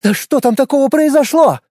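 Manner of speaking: high, loud demanding call; shouting. A narrator's reading. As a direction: call out after them. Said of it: "крикнула Надежда".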